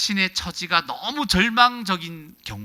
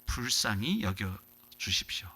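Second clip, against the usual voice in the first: humble plea; 6 semitones lower, 6 dB quieter than usual. Acted.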